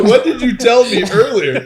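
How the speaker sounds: laughing disbelief. Retold or imitated. Rookie questioning the customer, laughing. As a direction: laugh it out, incredulous. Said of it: "I go".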